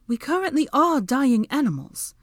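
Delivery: high, loud correction; exasperated retort. A narrator's reading. Woman answering talk of dying animals, exasperated retort.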